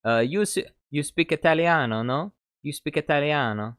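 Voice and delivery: imitating the coworker teasing in Italian accent; mock Italian accent. Older cook teasing the kid in a mock Italian accent. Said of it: "he would be like".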